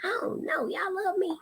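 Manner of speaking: high, soft, pretend-pitiful pleading; mock tearful. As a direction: fish for love in a put-on tearful voice. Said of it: "I'ma start crying".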